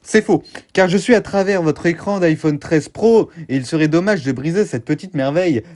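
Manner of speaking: normal talking voice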